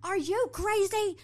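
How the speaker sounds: high, loud, slow shocked shriek; shocked cry. Retold or imitated. Taylor shrieking at the funeral home sign. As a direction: shriek it in disbelief.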